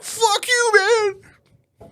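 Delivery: high, loud squeaky imitation of the guy; mock him in a shrill put-on voice; mock heckler yell. Imitating the heckler cursing him out, high mock yell.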